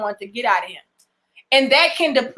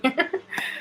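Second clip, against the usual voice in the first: soft, short real laugh; amused chuckle; 4 semitones higher, 9 dB quieter than usual. Real, not acted. Creator amused by a viewer saying she saved them, soft chuckle. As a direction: chuckle lightly to yourself.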